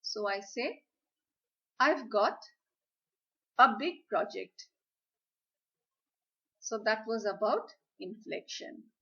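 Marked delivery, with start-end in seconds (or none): 1.8-4.7 louder, with a pause after "I've got"; pause before big. Shown as a demonstration. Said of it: "I use a pause".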